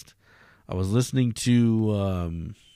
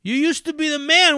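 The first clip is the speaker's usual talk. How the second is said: taunting cameraman. Retold or imitated. Cameraman lighting up the homeless man, high jeering taunt. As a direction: push the insult harder. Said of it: "he starts shitting on him".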